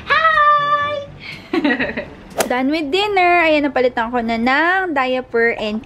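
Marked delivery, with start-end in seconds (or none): 0.1-0.9 put-on very high, louder baby voice. Mother greets viewers as her baby, high baby voice. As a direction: squeal a long hi for the baby. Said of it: "Say hi to them".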